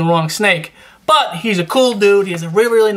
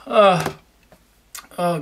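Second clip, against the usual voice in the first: usual speaking voice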